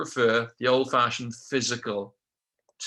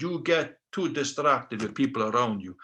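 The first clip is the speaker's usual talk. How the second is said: put-on Dracula-like Russian accent; Russian instructor sliding towards Dracula. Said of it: "I sound like Dracula now".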